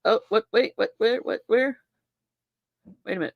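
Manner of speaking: ordinary talk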